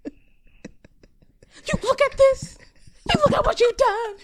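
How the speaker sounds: high, loud impassioned mock scream; hammed-up outburst, voice thrown way up; Tyra. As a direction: shriek it like a furious TV host.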